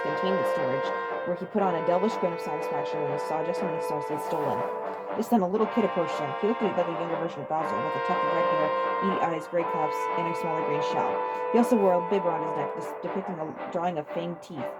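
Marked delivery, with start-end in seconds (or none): none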